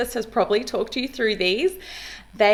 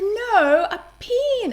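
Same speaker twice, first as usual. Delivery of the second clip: put-on much higher, a child correcting an adult; insistent child; 13 semitones higher, much slower than usual. Child insisting to therapist, high mock protest. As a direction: kid protesting, pitched way up. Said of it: "And they'll say".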